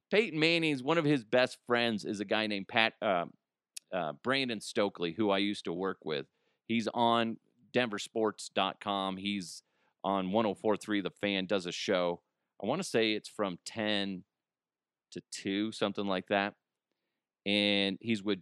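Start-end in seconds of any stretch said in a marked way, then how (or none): none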